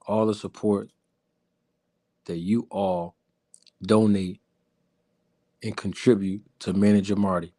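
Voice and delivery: a deep velvet voice for the ladies. Acted. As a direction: croon it low and gentle like an R&B singer charming the ladies. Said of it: "Put my smooth voice on".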